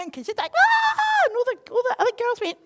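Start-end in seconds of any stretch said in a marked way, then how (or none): 0.6-1.3 very high, loud scream; mock scream. Girl's hair pulled, high shriek. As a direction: shriek like a hurt little girl.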